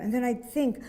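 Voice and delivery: ordinary delivery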